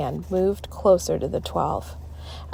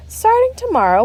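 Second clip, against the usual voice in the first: teacher voice; 5 dB louder than usual. A narrator's reading.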